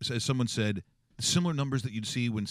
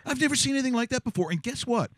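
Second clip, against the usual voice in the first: excited; 12 semitones higher than usual. Real, not acted. Gushing over the interview.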